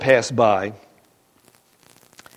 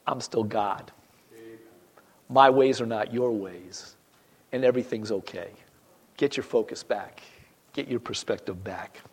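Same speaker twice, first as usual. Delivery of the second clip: gentle reassurance; 4 semitones lower, 9 dB quieter than usual. Acted. God's reassurance voiced softly.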